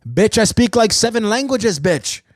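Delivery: high, punchy mock comeback.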